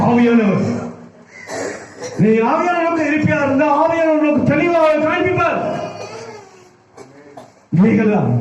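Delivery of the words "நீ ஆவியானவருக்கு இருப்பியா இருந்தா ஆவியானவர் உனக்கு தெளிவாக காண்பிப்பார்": high, unrelenting preacher's chant-like drive; full-force preaching. Acted. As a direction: pile up the phrases with fervour, pitched high. (உச்சக் குரலில் ஆவேசமான பிரசங்கம்)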